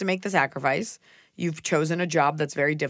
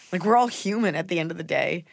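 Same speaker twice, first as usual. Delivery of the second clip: ordinary talk, nothing marked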